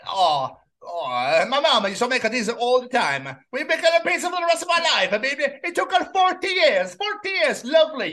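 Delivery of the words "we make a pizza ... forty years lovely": high-pitched hammed-up Italian; Italian accent. Imitating an Italian mama proud of her pizza, high Italian accent. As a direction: put on a comic Italian accent, voice lifted high. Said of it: "does an Italian accent".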